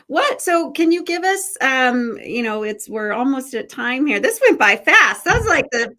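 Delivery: high and loud, bubbling over; excited. Real, not acted. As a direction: gush about how fast it went. Host surprised time flew.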